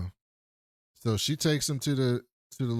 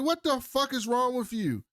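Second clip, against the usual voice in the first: mock indignant, much higher; indignant retort; 12 semitones higher than usual. Others snapping back at the cop, mock indignant and high. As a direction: fire it back, pitched way up. They snap back at Billings.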